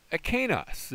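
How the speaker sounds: "Akenos" said high and clear as a model; teacher announcing the new word; careful pronunciation. Shown as a demonstration. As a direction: present "Akenos" to students. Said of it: "that forms my diphthong".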